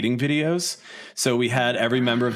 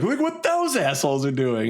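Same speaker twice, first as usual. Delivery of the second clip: higher-pitched parody of a rival's complaint; mimicked tattling; 4 semitones higher than usual. Musician mimicking the rival band's post to the festival, tattling back. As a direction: echo the call-out as the other side.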